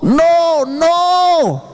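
high, long-held cries of refusal; acted divine shout. God shouting at someone choosing wrongly, high drawn-out cry. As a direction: stretch each one into a high warning call. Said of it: "Dios está gritando".

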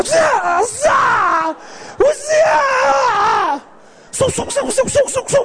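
high shouted ninja slashing sounds; hammed-up martial-arts whooshes; ninja noises. Mom whipping the hose like a ninja, mock ninja cries. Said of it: "era como una ninja".

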